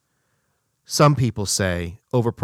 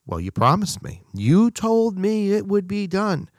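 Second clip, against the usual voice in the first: mock furious client; 8 semitones higher than usual. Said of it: "the client's furious and says".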